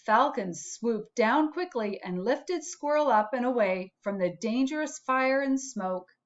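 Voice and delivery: plain reading voice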